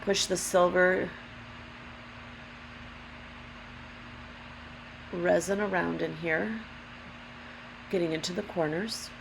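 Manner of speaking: rough, gravelly allergy voice. Real, not acted. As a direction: speak through a gravelly throat. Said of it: "Sorry for the gravelly voice today".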